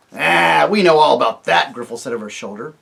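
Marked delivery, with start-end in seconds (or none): 0.1-1.7 loud dismissive dwarf. Acted. Griffle answers Eustace over his shoulder, dismissive. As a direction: wave it off.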